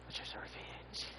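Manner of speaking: soft whispered aside; whispering. Real, not acted. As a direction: breathe it out under your breath. Aside after shouting Venganza.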